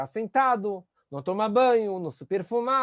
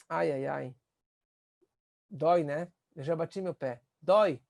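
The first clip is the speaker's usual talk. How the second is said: soft, lower sympathetic "ai, ai, ai"; empathetic comfort; 4 semitones lower, 3 dB quieter than usual. Shown as a demonstration. Parent comforting a hurt child, soft and lower. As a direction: feel the child's hurt with them, gently. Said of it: "você tem que falar pra ela".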